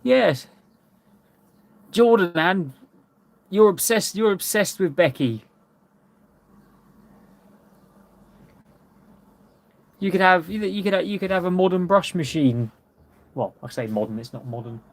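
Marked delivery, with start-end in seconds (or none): 1.9-12.7 playful teasing, pitched up. Real, not acted. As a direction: rib a friend, half joking. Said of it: "I'm only messing with you".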